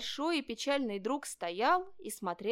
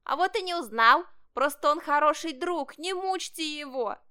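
high, loud kitten protest; shouting kitten; 6 semitones higher, 7 dB louder than usual. A narrator's reading. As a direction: cry out in defence of a friend. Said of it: "Котёнок, выскочив следом, закричал". (высокий крик котёнка в защиту друга)

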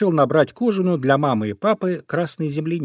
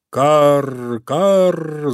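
loud cawing; crows cawing; 3 semitones higher, 5 dB louder than usual. Acted. Crows caw as they attack the fox, loud. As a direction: caw loudly like a flock. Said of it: "закричали вороны".

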